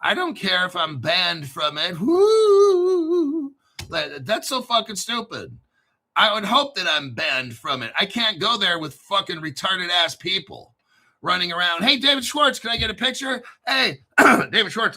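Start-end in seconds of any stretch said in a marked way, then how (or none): 2.0-3.5 high, loud mock whoop; mock cheer. Host mocking fans at a crypto concert, sarcastic cheer. 11.8-15.0 higher-pitched mock fanboy; starstruck fan. Fan asking David Schwartz for a picture, mock eagerness. Imitates fans begging for a picture.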